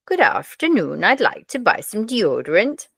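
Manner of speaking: put-on sing-song comic Swedish accent, pitch swooping up and down; lilting mock-Swedish customer. Swedish man asking a pharmacist for deodorant in a joke, comic Swedish accent. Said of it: "A Swedish man walks into the chemist's".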